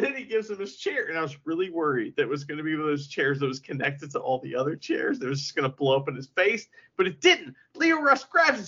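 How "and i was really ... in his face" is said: ordinary talk, nothing marked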